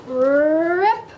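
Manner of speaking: loud rising, rolled-out rip; ripping sound. A narrator's reading. Oscar tugging with all his might, loud rip. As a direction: stretch the word upward like tearing cloth. Storyteller imitates costume tearing.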